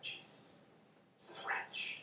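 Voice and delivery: barely voiced, awed whisper; alarmed hushed aside. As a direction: whisper it to yourself in dread. Overhears strange voices on road.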